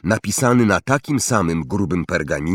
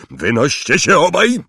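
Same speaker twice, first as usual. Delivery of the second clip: loud, higher bark; angry order; 6 semitones higher, 5 dB louder than usual. A narrator's reading. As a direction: throw them both out with your voice. Uncle orders boys out. (gniewny, głośny rozkaz)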